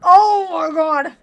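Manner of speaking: high, loud, drawn-out gush; overwhelmed exclamation. Real, not acted. Fan reacting to the hero's voice, overwhelmed exclamation.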